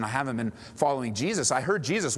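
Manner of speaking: usual speaking voice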